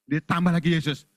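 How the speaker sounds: ordinary talk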